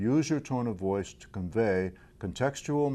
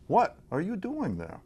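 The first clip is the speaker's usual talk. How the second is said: "What" punched high, the rest falling away; stress on WHAT; 8 semitones higher than usual. Shown as a demonstration. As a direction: hit the word "What" hard at the start. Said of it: "demonstrate five different meanings".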